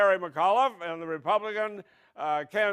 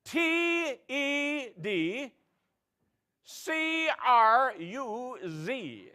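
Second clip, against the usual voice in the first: slow, higher, letter by letter; contestant spelling; 4 semitones higher, 4 dB quieter, much slower than usual. Pretend. Contestant spelling the punchline, slow and higher. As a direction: drag out each letter.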